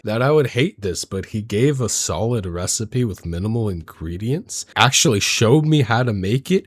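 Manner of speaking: ordinary delivery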